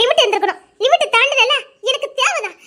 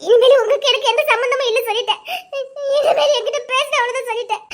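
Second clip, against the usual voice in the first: ordinary delivery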